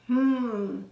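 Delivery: drawn-out thoughtful hum; mulling it over. A narrator's reading. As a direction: hum as if weighing an idea. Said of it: "she was thinking it over".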